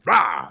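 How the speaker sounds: mock battle roar. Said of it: "Denji goes".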